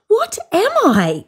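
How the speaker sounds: high, questioning creature voice; bunyip's wondering voice. A narrator's reading. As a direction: ask it like a lost young creature.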